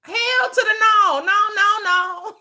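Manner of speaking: high, loud "hell to the no"; loud sassy no; emphatic refusal. Acted. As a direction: belt out the refusal with attitude. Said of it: "You think I'm gonna turn down".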